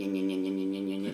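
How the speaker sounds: low buzzing imitation of an electric can opener; can opener noise. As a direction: buzz it low like the motor grinding. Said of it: "It's an electric can opener".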